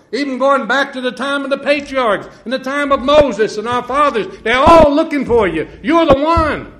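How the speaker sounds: high, loud preaching cadence; rising revival sermon voice; fervent preaching. Acted. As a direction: lift it into full fervent preaching. Preaching at full force.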